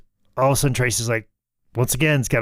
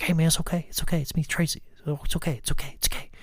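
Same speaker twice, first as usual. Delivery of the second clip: raised, gentle talking-down of an angry man; hushed reassurance, higher and softer; soothing calm-down voice; 4 semitones higher, 5 dB quieter, faster than usual. Retold or imitated. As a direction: coax him down softly like a friend. Tracy talking someone down.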